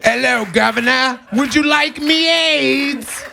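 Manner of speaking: high, drawn-out mock British voice. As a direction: ham up a posh Brit, way slowed.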